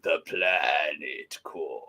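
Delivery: low, slow mock villain; evil Boss Nass. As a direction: drag it out low like a menacing alien boss. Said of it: "why does Boss Nass just go evil sometimes".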